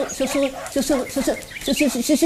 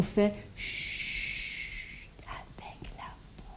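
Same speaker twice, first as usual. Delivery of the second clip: shushing whisper; 4 semitones lower, 19 dB quieter, much slower than usual. Shown as a demonstration. Teacher whispering to children to show how to be quiet. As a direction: whisper it as if hushing a class. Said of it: "on regarde avec ses oreilles et on".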